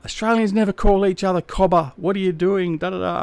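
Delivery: high, whiny parody of a critic; lightly voiced, mimicking a snooty messager; mocking complainer. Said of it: "a message saying".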